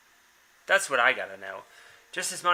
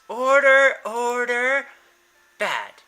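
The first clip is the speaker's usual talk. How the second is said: scolding call; 7 semitones higher, 6 dB louder, much slower than usual. Acted. Rin scolding cats.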